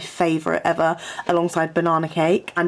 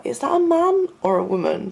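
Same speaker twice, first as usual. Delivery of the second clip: higher mock-puzzled quote; imagined puzzled listener; 5 semitones higher than usual. Imagining the phone operator's reaction to a croaky caller, mock-puzzled. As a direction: imitate someone wondering who's calling. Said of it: "Who's probably like".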